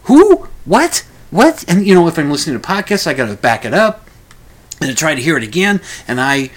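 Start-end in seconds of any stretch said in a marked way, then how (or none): 0.0-1.7 high, loud, puzzled questions; baffled who what. Retold or imitated. Listener who can't catch a mumbled name, baffled and loud. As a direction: sound totally lost on the name.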